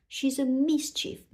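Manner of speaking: mild moan. A narrator's reading. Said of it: "moaned Rabbit".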